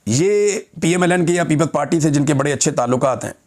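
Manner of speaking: ordinary talk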